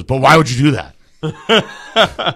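loud, drawn-out mock reproach; retold stern disbelief. Dave asking after minutes of silence, slow stern reproach. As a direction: stretch it slowly, imitating someone still baffled.

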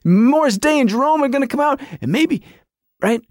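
high, fast fan babble; acting out a giddy fan's rising anticipation; retold excitement. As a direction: race through hopeful guesses, getting carried away. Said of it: "And I'm like".

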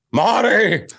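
high excited Doc Brown cry. Acted. Comedian joking with host before the story, Doc Brown voice. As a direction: call out like a startled mad scientist. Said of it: "my Doc Brown".

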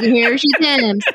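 laughing drawl mimicry; put-on drawl. Shown as a demonstration. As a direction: stretch it out in an imitated drawl. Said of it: "Like, you have a drawl".

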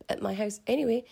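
ordinary delivery

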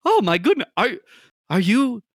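high, loud, nervous peeking voice; timid shopkeeper. Acted. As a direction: ask warily, high-pitched. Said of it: "and he goes".

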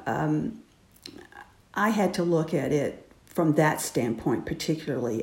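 usual speaking voice

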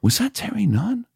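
mock gossiping crowd voice; imitating the crowd. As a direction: squeak it out like a stunned onlooker. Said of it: "And the crowd's going".

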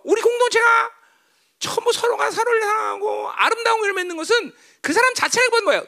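high-pitched, insistent sermon delivery; forceful preaching. Real, not acted. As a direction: drive the point home to the congregation.

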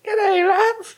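very high, mock-girlish Aussie falsetto; Australian falsetto. Shown as a demonstration. Told to just use falsetto, high comic Australian girl voice. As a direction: squeak out an Aussie greeting in falsetto. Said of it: "Just your falsetto".

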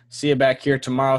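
usual speaking voice

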